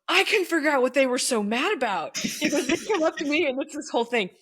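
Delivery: high, laughing retelling; laughing disbelief. Retold or imitated. Principal laughing while retelling.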